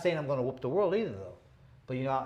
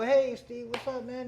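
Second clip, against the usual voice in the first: higher, louder hey to a friend; cheery greeting; 8 semitones higher, 3 dB louder, slower than usual. Retold or imitated. Boxer greeting his doctor friend on arrival, cheerful call. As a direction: call out hello across the driveway.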